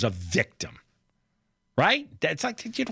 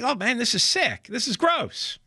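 high, disgusted mock reaction; 8 semitones higher than usual. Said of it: "It's like".

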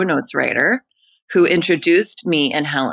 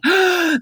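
sharp, high amazed gasp; gasp of wonder; 13 semitones higher than usual. Retold or imitated. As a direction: gasp like a dazzled kid. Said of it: "he was like".

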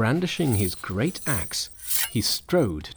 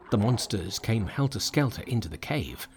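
ordinary delivery

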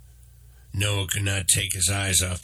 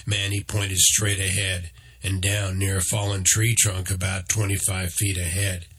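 usual speaking voice

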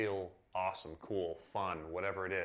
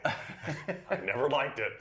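laughing; 6 semitones higher, 4 dB louder, much slower than usual. Real, not acted. Laughing recalling car dealer ads.

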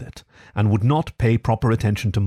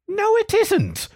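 much higher, slow indignant "No, it isn't" with "No" stressed and falling; indignant cry; slower than usual. A narrator's reading. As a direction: cry out in protest. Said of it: "cried the rat indignantly".

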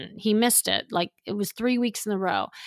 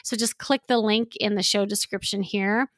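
usual speaking voice

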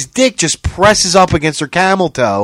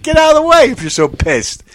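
ordinary talk, nothing marked

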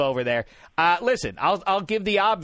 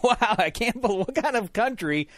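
mock outrage; 6 semitones higher than usual. Mock outrage over no pretzels.

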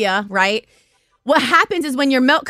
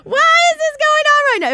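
very high comic wail; mock crying; 13 semitones higher than usual. Nursing mom standing with two cups, acted comic crying wail. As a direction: wail it like a hammed-up sob, half laughing. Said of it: "I'm literally standing there crying".

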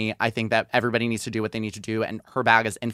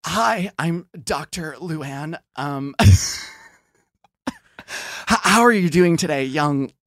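lightly voiced cheery doctor; a hint of a fussy doctor; doctor role play; 5 semitones higher, 4 dB louder, slower than usual. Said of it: "you're the doctor this time".

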